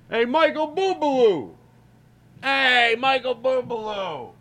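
put-on high, slow drunken yell across the club. Drunk fan yelling a singer's name from the table. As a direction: holler his name at the stage, drawn out. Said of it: "you start yelling out".

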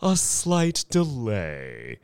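high, slow, oily host voice; slow slick showman; smooth announcement. A narrator's reading. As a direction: ease the bad news out slowly. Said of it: "said Mister Thurkle".

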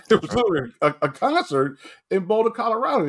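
high-pitched, laughing disbelief; amused amazement. Real, not acted. Recalling stars at a Colorado game, amused amazement.

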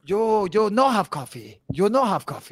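mock Chinese accent, broken English; playfully imitating a Chinese waiter's accent.

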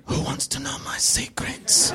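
slow, hushed mock-sinister whisper; creepy whisper. Stranger turning halfway to the couple, creepy whisper. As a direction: whisper it slowly like a lurking villain.

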